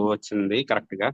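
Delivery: normal talking voice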